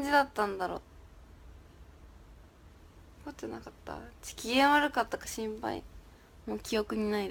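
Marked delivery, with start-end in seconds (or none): 3.2-4.0 breathy, unvoiced whisper of worry; whispered anxious question. Real, not acted. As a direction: hush it as if sharing a secret. Repeats the question in a hush.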